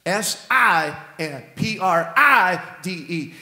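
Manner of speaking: letters S I N and P R I D E spaced out one by one; spelled out slowly. Shown as a demonstration. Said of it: "pride and sin both have an I".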